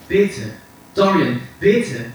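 high, loud, insistent plea; emphatic, slow, imploring command; urgent. A narrator's reading. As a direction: press him hard, drawing out the last word. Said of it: "in leisem, eindringlichen Ton".

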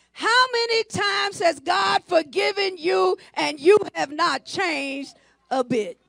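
slow, drawn-out preacher's shout; stretched, ringing sermon delivery; full-force preaching. Real, not acted. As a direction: drive each phrase home to the congregation.

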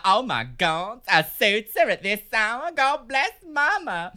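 hammed-up Southern dame voice; slow Southern drawl, rising high at the end; southern accent. Manager playing the Southern girl for a customer, Southern accent. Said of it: "I put the voice back on".

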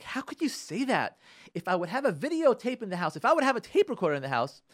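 high-pitched, affronted protest; indignant accusation. Acted. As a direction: act out a spouse who feels misquoted. Acted husband accusing his wife.

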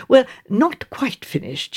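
slow, fawning correction; smooth it over sweetly, slowly; coaxing the charwoman, slowly and ingratiatingly. A narrator's reading. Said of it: "she added ingratiatingly".

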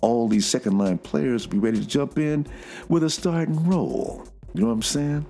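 low, steady imitation of a friend's musing; lightly voiced HBK, calm and low; imitating HBK. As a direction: hold the voice low and even, playing HBK. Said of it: "my H B K imitation".

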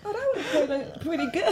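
laughing. Retold or imitated. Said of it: "afterwards, I'm like".

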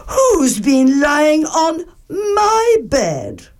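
Mother Bear finds her bed used, high and tearful; Mother Bear, upset. Acted. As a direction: plead it like a hurt mama bear.

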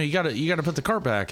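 mild cart cop voice, a bit fast; 1 imitating the cart cop. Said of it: "He's like".

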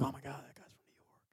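hushed, breathy aside in awe; soft whispered gossip about a stranger; mock whisper. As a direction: whisper it like an impressed fan. Mock reaction to a New York comic.

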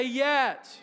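loud, high shout of yet; preacher leading the church in a shout; shouted call. Shown as a demonstration. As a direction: belt it so the room answers. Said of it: "everybody say".

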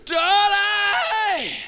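high, loud drawn-out call; screaming for daddy. Retold or imitated. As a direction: scream it as a frightened child would. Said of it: "she started to scream".

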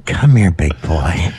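low, slow seductive come-on; sultry voice. Pretend. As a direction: purr it like a temptress. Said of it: "It's talking your normal voice".

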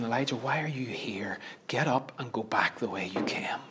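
hushed, low whisper; whispered divine voice. Retold or imitated. God whispers to Elijah hiding in the cave, whispered. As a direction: whisper it as a still small voice. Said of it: "he hears that whisper saying".

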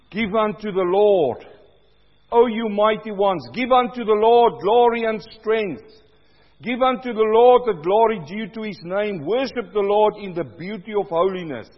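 loud, measured psalm reading; booming scripture proclamation; proclaimed scripture. Acted. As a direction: declaim it to the congregation.

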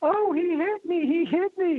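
very high-pitched imitation of a rider's wail; high, slow mock holler; mock yell. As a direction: imitate someone crying out in a squealing high voice, drawn out. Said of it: "wheeling, and he yells".

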